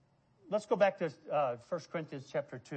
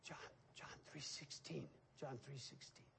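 breathy timid whisper; imitated whisper; 4 semitones lower, 22 dB quieter than usual. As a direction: whisper it shyly like a nervous boy. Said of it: "That's how he spoke".

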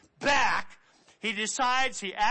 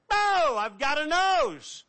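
high, loud delighted gasp of discovery; amazed; 7 semitones higher, 4 dB louder than usual. Acted. Healed leper touching his new nose, high and astonished.

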